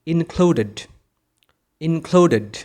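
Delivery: "included" with the e in -ed sounded; teacher's model "included", final -ed a full syllable. Shown as a demonstration. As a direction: say "included" slowly as in-clu-ded. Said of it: "ই কে উচ্চারণ করতে হবে".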